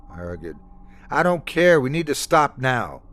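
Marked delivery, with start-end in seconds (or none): 1.1-3.0 higher and louder, insisting hard; stronger, forceful. Acted. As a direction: put your foot down. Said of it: "Lena's voice grew stronger".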